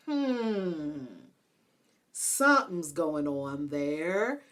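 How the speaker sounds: a long, musing hum, then slow words; intrigued suspicion. Retold or imitated. Speaker recalls seeing [name]'s wife, intrigued suspicion. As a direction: draw it out as if puzzling it over. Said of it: "I was like".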